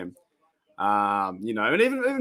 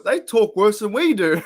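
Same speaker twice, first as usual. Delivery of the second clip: 1 laughing; 8 semitones higher, 6 dB louder than usual. Real, not acted. Laughing at Pittsburgh slang.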